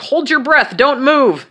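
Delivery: high, projected commands; imitating a doctor calling out during an x-ray; calling out orders. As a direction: call it out high and firm.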